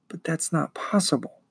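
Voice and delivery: softly spoken, understated alarm; alarmed wondering. Acted. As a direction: murmur it in quiet disbelief. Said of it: "Bastian wondered in alarm".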